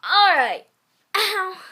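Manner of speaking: a sudden loud call to move on; loud all right. Real, not acted. Moving on from a fanfic, calling out loudly. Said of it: "sudden loud exclamation".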